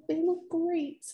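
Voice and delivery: high-pitched admiring gush. Retold or imitated. Wedding guest praising the bride's lashes, gushing admiration. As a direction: imitate an impressed guest, high and light.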